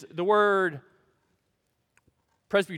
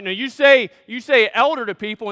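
higher and louder, driving the point home; preaching emphasis; 6 semitones higher, 11 dB louder than usual. Real, not acted. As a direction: lift it like a pulpit call.

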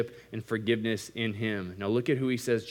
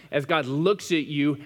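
ordinary talk, nothing marked

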